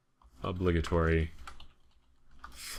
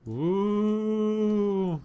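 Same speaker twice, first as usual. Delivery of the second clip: a high, sudden whoop of joy; excited whoop. Real, not acted. Gamer whooping at his capsule, high excited cry. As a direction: cheer the capsule on.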